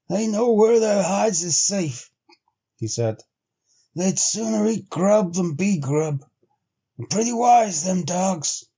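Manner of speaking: lightly voiced frontiersman, slow and dry; a hint of a grizzled sled driver; Henry, a weathered trail man. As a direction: give Henry a plain-spoken backwoods drawl. Henry's lines in the story.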